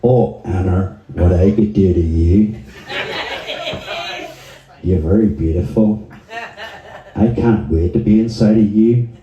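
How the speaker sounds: comic seduction in an Irish lilt; mock-sexy Irish chat-up voice; Irish accent. Shown as a demonstration. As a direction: sweet-talk her in Irish.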